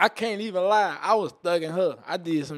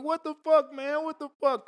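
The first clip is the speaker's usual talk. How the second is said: imitated crying shout; 11 semitones higher, 4 dB louder than usual.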